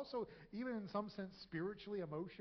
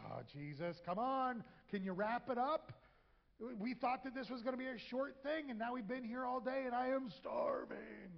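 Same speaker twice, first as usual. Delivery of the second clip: hangry whine; 6 semitones higher than usual. Acted. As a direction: moan it like a starving, impatient friend. Hangry disciples whining to Jesus.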